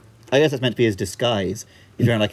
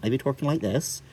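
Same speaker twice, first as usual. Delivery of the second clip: softer mock West Country burr; Hot Fuzz accent; 5 dB quieter than usual. Shown as a demonstration. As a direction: talk like a Bristol pirate, West Country accent. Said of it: "It's the Hot Fuzz accent".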